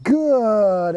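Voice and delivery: "good" held long on a falling glide; drawn-out marker word. Shown as a demonstration. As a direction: stretch "good" out slowly, easing down. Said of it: "there's a thirty-second pause".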